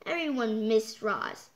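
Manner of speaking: plain reading voice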